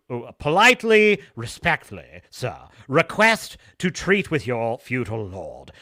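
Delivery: a hint of a groveling envoy; humble merchant. Blackthorne politely petitioning the samurai, mock courtly tone. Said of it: "he's claiming to be a mer".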